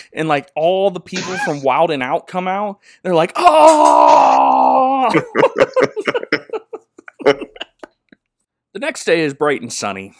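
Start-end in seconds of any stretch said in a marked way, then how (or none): 3.4-5.1 loud mock crowd "Oh!"; a high, loud whooping "Oh!"; crowd shout. Wild 'N Out crowd reacting. 5.1-6.8 high, loud cheering yelp; loud whoop and laugh; whooping shout. Retold or imitated. Crowd cheering.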